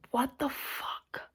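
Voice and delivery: slow, stunned whisper; whispered disbelief. Real, not acted. After ranting about the movie, a slow stunned whisper. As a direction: breathe it out in horror.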